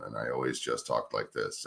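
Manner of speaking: low, soft, flat monotone with no inflection. Shown as a demonstration. Advising would-be broadcasters, demos his teen low monotone. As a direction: drone it out like a bored teen. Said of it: "everything I said was monotone".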